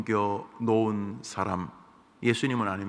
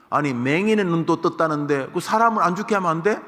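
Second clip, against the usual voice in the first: higher, louder taunting question; a loud, higher sneering jab; mocking jeer; 7 semitones higher, 6 dB louder than usual. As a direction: throw it at him like a dare. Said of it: "비아냥거립니다".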